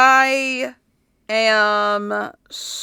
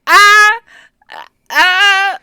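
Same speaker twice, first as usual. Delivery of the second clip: panicked; 8 semitones higher, 10 dB louder, slower than usual. Retold or imitated. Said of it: "I'm so scatterbrained that I'm like".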